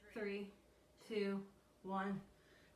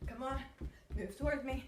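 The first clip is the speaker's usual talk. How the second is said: excited coaching; 5 semitones higher than usual. Real, not acted. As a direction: fire up the class. Hyping up the class.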